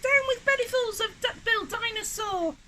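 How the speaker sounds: very high-pitched creature chant; hooting. A narrator's reading. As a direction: hoot it like a little dinosaur. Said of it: "and he hooted".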